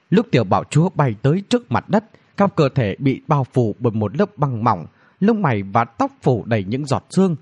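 usual speaking voice

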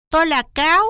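high, loud little fox voice; high-voiced fox introducing itself; fox character. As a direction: pipe up as a fox at the door.

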